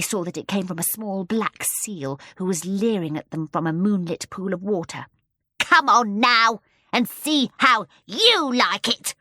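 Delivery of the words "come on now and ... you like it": slow, louder sneering dare; drawn-out spiteful taunt; bitter, angry. Acted. Seal dares the children to swim, slow and bitter. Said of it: "a bitter, angry voice".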